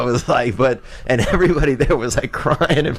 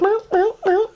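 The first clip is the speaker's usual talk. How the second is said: high mock meowing like a cat; squeaky cartoon cat meow. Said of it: "and he's like".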